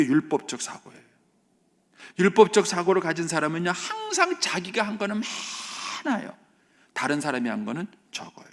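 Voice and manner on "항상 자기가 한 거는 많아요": hammed-up boastful tone; mocking self-importance. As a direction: stretch it high to mock a boaster. Mimicking the self-righteous person's boast.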